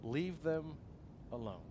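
quiet and grave, fading to almost nothing; chilling. Retold or imitated. Pastor quoting Jesus' chilling words, quiet and grave. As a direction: let the words drop softly and coldly. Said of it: "three very chilling words".

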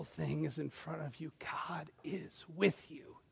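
slow, soft whispered promise; hushed whisper. Real, not acted. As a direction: whisper it like a secret comfort. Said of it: "preacher drops to a whisper".

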